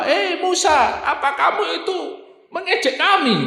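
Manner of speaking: high, affronted accusation at Musa; mock indignant, pitched high; accusing anger. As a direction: voice the people snapping back at their prophet. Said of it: "Marah-marah, Pak".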